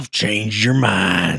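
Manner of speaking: slow cowboy drawl; Arthur Morgan. Acted. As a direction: stretch it out like a slow game cowboy. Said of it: "that's Arthur Morgan".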